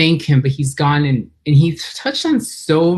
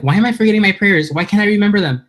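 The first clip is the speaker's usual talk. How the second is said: higher, mock-panicky retelling of her inner voice; panicked self-quote; 6 semitones higher, faster than usual. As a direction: replay the moment she lost her prayers, a little frantic. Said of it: "and I freaked out".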